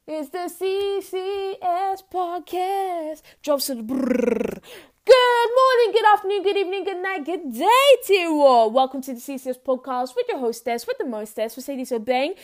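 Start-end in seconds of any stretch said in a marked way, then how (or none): 0.1-4.6 slow, drawn-out announcer intro; podcast intro voice. Shown as a demonstration. Hostess opening her podcast for listeners, in a slow announcer voice. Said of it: "that's how it's supposed to be done". 5.0-8.7 high, loud, showy welcome; loud hosting greeting. Shown as a demonstration. Host greeting her listeners, loud and high-pitched. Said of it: "that's how it's supposed to be done".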